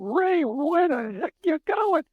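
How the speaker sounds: high, drawn-out call; acted-out shout to someone walking off; retold yell. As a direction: holler after him, high and slow. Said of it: "I yell out".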